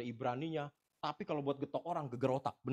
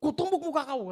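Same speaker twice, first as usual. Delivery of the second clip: loud high shouted threat; mock furious shout; 11 semitones higher, 8 dB louder than usual. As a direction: hurl the threat again like the angry man.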